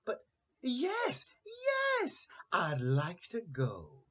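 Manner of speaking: high, rising cat's mew. A narrator's reading. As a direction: let the cat's yes climb up into a mew. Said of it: "mewed the cat".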